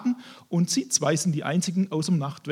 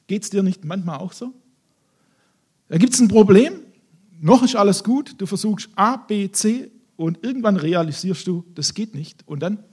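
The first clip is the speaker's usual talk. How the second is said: ordinary delivery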